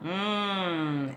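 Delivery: soft, sustained hum; long closed-mouth hum. Shown as a demonstration. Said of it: "is to hum".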